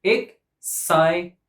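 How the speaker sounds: slow "Exciting" in three separate beats; syllable by syllable. Shown as a demonstration. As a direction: break "Exciting" apart, ex, cit, ing. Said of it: "Three syllables".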